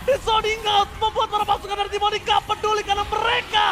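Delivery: fighting game announcer. Real, not acted. Caster calls the winning push.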